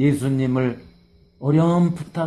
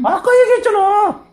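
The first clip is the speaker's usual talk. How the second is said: much higher, loud taunting whine; mocking exasperation; 9 dB louder than usual. Blind man mocking the Pharisees' questions, high and loud. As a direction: squeal it like you already said so. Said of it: "이 말이에요".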